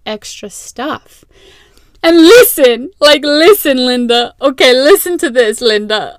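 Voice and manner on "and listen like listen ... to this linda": loud, sassy "listen, Linda"; mock sassy call-out. Vlogger jokingly calling out an imaginary Linda, mock sassy. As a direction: tell off an imaginary friend, half-joking.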